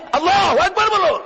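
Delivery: high shouted rallying cry; rousing call. Real, not acted. Preacher prompting takbir from the crowd, high shout.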